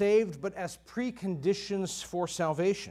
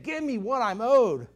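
demanding servant; 5 semitones higher, 5 dB louder than usual. Acted. Servant choking his debtor.

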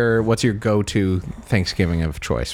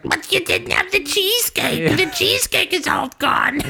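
relative voice. Retold or imitated. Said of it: "someone's like".

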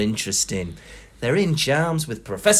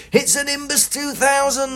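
McGonagall voice; 12 semitones higher than usual. A narrator's reading. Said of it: "McGonagall says".

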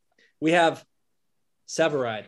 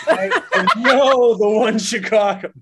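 laughing boast; 3 semitones higher, 6 dB louder, slower than usual. Real, not acted. Mock boast while laughing.

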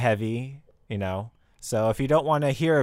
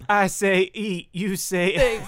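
slow, higher chant like a DJ hyping a crowd; mock DJ call-out; 5 semitones higher, much slower than usual. Playing DJ leading a call and response, slow raised chant. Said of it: "like, D J call out".

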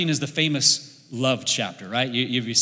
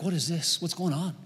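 softer, hushed aside; hushed question; 6 dB quieter than usual. Retold or imitated. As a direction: ask quietly, curious. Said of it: "I'm like".

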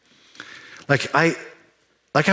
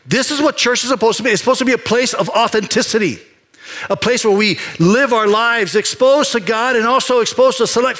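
full preaching; 10 semitones higher than usual. Acted. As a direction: rise up and preach it to the congregation.

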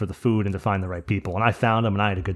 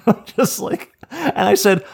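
laughing; 11 semitones higher, 6 dB louder, slower than usual. Real, not acted. Laughing while retelling ashes story.

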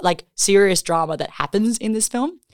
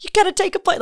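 heroic tough guy; 13 semitones higher, faster than usual. Retold or imitated. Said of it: "walks up to them and is like".

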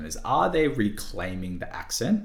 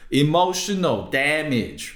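high, loud, drawn-out exclamation; loud, slow catchphrase imitation; Stephen He catchphrase; 9 semitones higher, 7 dB louder, slower than usual. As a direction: stretch it out loud in a mimicked comic voice. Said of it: "He's the guy that goes, like".

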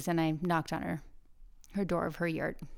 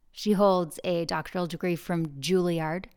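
ordinary delivery

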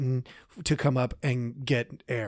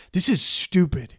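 irritated outburst; 5 semitones higher, 4 dB louder than usual. Retold or imitated. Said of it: "super irritated, frustrated".

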